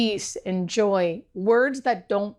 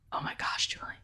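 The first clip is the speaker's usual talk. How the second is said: hushed, whispered amazement; retold whisper of joyful disbelief; 11 dB quieter than usual. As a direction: whisper it in wonder and joy. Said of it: "He was kind of, like, whispering".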